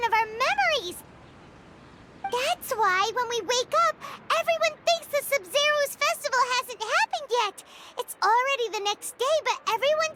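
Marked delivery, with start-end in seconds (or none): none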